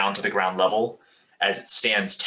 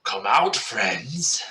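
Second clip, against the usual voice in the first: slow, mocking call to hidden friends; taunting dragon; 3 semitones higher, much slower than usual. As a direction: coax them out like a predator.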